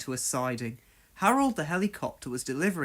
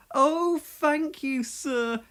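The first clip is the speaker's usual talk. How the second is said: very high, slow delighted thanks; overjoyed at the news; grateful; 10 semitones higher, much slower than usual. A narrator's reading. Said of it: "explained Percy".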